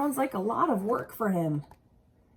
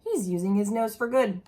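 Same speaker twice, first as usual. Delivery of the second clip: usual speaking voice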